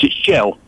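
"gel", which is leg said backwards; backwards speech. Shown as a demonstration. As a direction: say "gel", the word leg reversed. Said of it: "if you say leg and say it backwards".

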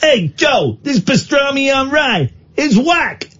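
high, shouted comic American; hammed-up American yell, very high; American accent. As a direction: holler it in a mock-American accent. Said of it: "from the United States of America".

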